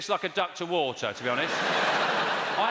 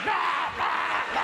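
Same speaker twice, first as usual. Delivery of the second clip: high mock shout like kids let loose; kids going wild; 11 semitones higher, much slower than usual. Comedian imitating school kids going mad, shouting. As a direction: yell like children at playtime. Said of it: "And the kids are go".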